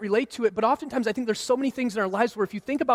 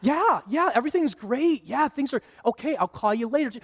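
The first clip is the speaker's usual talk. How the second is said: higher, lilting fake-friendly voice; put-on pleasant phone chat; 4 semitones higher than usual. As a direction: mimic sweet small talk on a call. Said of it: "you can easily just flip the switch".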